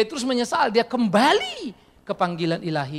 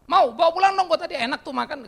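higher and louder, eager; eager request; 8 semitones higher, 5 dB louder than usual. Retold or imitated. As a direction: ask keenly to take it home. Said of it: "Saya bilang". (suami bersemangat minta bawa pulang makanan, nada tinggi dan keras)

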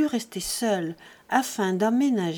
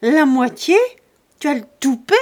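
loud, higher, scornful cry; outraged interruption; 5 semitones higher, 9 dB louder than usual. A narrator's reading. Lise interrupting Jean's claim to half, outraged shout. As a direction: cut him off in disbelief. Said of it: "reprit Lise en l'interrompant".

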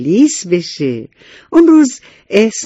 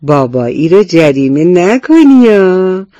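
slow, louder laughing tease; خندان و شوخ; 3 semitones higher, 7 dB louder than usual. Acted. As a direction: tease the boy through a laugh. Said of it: "پدر خندید و گفت". (شوخی خندان پدر با پسرش)

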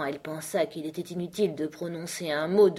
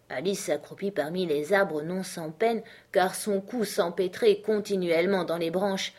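ordinary delivery

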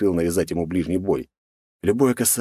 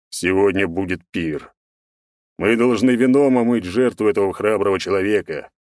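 solemn proclamation. Acted. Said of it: "озвучил всем свою волю Мозов".

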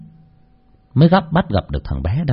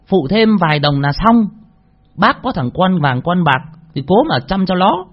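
cheerful rough laborer; 9 semitones higher than usual. A narrator's reading. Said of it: "vui vẻ bảo".

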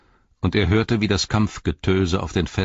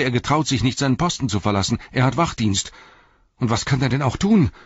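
high, fast, breathless torrent of words; spilling it out in panic; 6 semitones higher, 3 dB louder, faster than usual. Acted. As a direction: pour it all out in a frantic rush. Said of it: "So sprudelte Pippin die Geschichte hervor". (hervorsprudelnd, hoch und hastig)

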